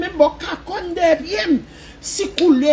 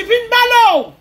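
preaching shout; 9 semitones higher, 7 dB louder than usual. Acted. Preaching emphasis.

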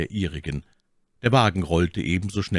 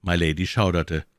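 plain reading voice

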